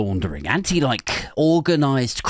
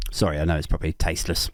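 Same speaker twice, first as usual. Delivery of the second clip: ordinary talk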